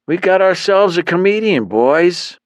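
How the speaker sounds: high, sneering gang boss voice; mocking thug leader. Gang leader mocks Myron in front of his boys, sneering high. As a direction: sneer it out like a cocky goon.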